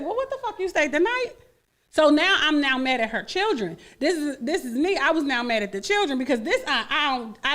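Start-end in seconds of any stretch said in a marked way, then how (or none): 0.0-1.3 indignant disbelief. Retold or imitated. Retold confrontation of friend.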